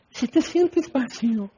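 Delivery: high, airy mock invitation; soft evangelist voice. Preacher imitating an appeal to those who feel empty, soft evangelist voice. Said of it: "ya estás en su voz así".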